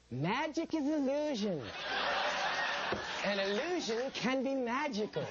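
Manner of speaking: high, slow, earnest magician voice; Doug Henning impression. Playing a magician on a special, high and slow. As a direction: breathe it like a dreamy mystic magician. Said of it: "and he'd say".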